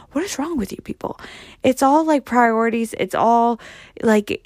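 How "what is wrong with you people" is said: hushed, high-pitched incredulity; acted inner exasperation; exasperated disbelief. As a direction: think it to yourself, baffled and softly. Acts out exasperated inner thought.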